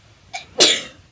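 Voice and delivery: loud real sneeze; sudden explosive sneeze; sneezing. Real, not acted. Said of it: "I have to sneeze".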